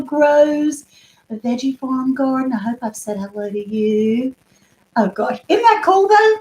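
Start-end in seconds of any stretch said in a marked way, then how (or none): 5.5-6.4 much higher, louder gleeful question; excited. Real, not acted. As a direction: squeal it to the chat. Gushing about new gadget.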